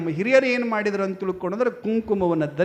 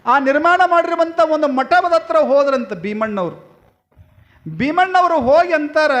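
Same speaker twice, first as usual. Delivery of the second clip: high, loud religious storytelling; full-force preaching; 6 semitones higher, 5 dB louder than usual. A narrator's reading. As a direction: project the tale out to the whole congregation.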